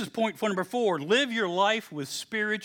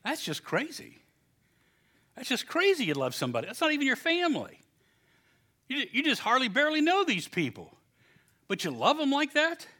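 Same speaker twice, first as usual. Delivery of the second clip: a hint of incredulous outsiders, higher; mocking the world; 7 semitones higher than usual. Preacher imitating worldly people baffled by church love, mildly incredulous. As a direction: lightly play a baffled skeptic. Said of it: "they think".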